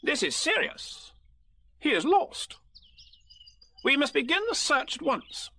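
high, self-important Rabbit; grave proclamation in a high-pitched voice. Acted. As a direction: announce it with great importance. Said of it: "he looked very important".

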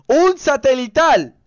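high, loud, drawn-out shout; exclamación a gritos. Real, not acted. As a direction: finally shout it out, slowly. Se traba y lo suelta gritando. (gritado, agudo y alargado)

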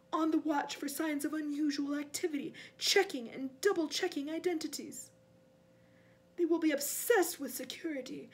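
impatient, commanding villain; cold master. Acted. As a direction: lecture a foolish servant. Cold master rebukes the servant.

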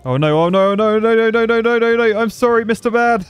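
rapid-fire no's climbing high and loud; comic panic. Real, not acted. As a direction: plead frantically as it all goes wrong. Plane crashing, panicked pleading.

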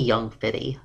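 usual speaking voice

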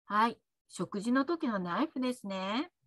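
ordinary talk

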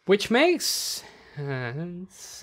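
very drawn-out, doubtful concession; reluctant agreement. Real, not acted. Podcaster conceding a film change they dislike, reluctant agreement. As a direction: stretch it out slowly, hanging at the end. Grudging agreement before "don't like it.

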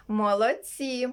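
ordinary delivery

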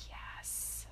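barely audible, drawn-out whisper; hushed inward affirmation; whispered yes. Real, not acted. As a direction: breathe the word out in a whisper. Whispered yes inside the meditation.

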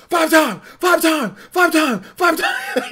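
high shouted wrestling catchphrase, slowing at the end; Booker T catchphrase. Retold or imitated. Fan imitating Booker T's ring entrance, high shout. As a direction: belt it out like a wrestler on the mic. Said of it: "when he'd come out and say".

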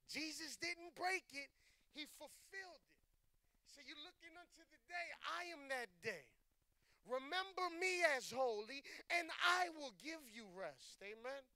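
high, loud, emphatic pulpit proclamation; full-force preaching. Real, not acted. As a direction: preach it out to the whole congregation.

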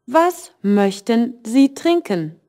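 slowed German question for learners; slow, careful teacher question; slow model question. Shown as a demonstration. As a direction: read it slowly for the class. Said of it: "And for questions".

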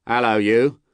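lightly voiced guard calling out; guard's hail. A narrator's reading. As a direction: hail the stranger. Guard hails the rider.

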